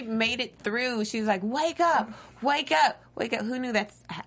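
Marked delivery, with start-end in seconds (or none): 1.4-3.4 high-pitched imitated calling; acted wake-up call. Priscilla telling herself to wake, acted urgency. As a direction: urge it repeatedly, up high.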